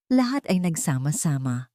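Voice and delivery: plain reading voice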